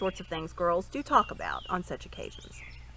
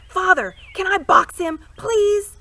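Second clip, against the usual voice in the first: high, loud eager shout; 12 semitones higher, 13 dB louder, slower than usual. A narrator's reading. Boy shouts to his father, eager to fight. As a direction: call out, begging to fight. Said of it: "and Corin shouted".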